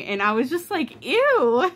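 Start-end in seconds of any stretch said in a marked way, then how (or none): 1.0-1.7 high, loud squeal of disgust. Real, not acted. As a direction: recoil with a shrill ew. Reacting to creepy book line.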